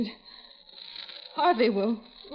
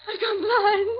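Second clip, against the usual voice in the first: tearful; 9 semitones higher, slower than usual. Acted. Blind woman panics.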